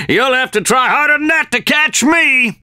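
cocky shouted taunt. Acted. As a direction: yell it over your shoulder while fleeing. Taunting while fleeing.

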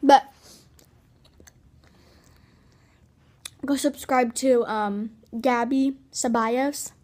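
talking around chewed wad; chewing gum. Real, not acted. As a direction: mouth full of gum. Seen 0: cheeks bulge and lips shift around a large wad throughout.

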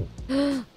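short, soft gasp. Real, not acted. Player spots the zombies, quick gasp. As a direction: catch your breath in a quick gasp.

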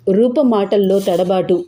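plain reading voice

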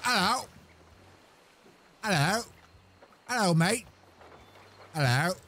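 put-on high, squeaky little-kid hello; son's voice. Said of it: "my son right there saying".

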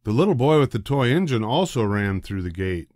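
usual speaking voice

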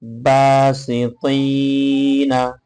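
drawn-out chanted recital of বাসি তৈ না; slow Quran recitation. Shown as a demonstration. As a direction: stretch every vowel in slow tajweed. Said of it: "এবার আমরা, আহ, উচ্চারণটা পড়ব".

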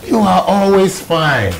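high, loud, drawn-out exclamation; big slow teasing boom; hearty exclamation. Acted. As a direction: exclaim it slowly, pitched well up. Boss's exclamation at polite refusal.

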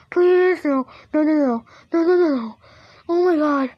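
panicked pleading. Real, not acted. Player pleading after a jump scare, panicked. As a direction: beg in panic, easing slightly.

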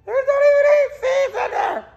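high crying voice; high teary wail. Real, not acted. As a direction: wail it through the tears. Repeated higher, crying.

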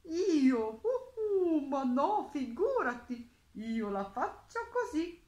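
loud, high hooting pigeon reply; storybook pigeon, hooting then explaining; cooing pigeon. A narrator's reading. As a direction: coo like an affronted pigeon. Pigeon answers the mole in story.

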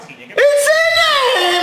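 high, drawn-out cry of the name; anguished shout. Real, not acted. Fan watching Insigne shoot high, anguished scream. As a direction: wail the player's name at the missed shot.

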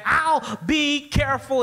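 put-on high, stretched-out kid's reply; mock kid's yell; boy yelling back. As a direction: sing it back like a heedless boy. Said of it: "Johnny yelled back".

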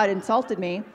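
normal talking voice

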